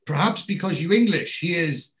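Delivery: high-pitched imitation of an obliging nurse; changed tune. Nurse accepts help from the two visitors, imitated obliging tone. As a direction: play the nurse coming round, voice lifted. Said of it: "the nurse changes her tune a little bit".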